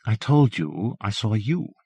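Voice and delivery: "you" leaned on gently, slowly; gentle stress on you. A narrator's reading. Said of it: "Her gentle emphasis on the last word".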